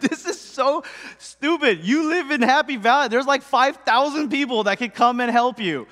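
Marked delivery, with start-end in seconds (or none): 0.0-1.8 laughing through the words, slowly; self-mocking laugh. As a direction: chuckle at your own dumb idea. Laughing at his own foolishness.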